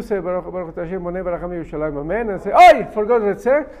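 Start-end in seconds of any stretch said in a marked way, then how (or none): 2.5-3.7 dismayed exclamation. Retold or imitated. Acted dismay at forgetting.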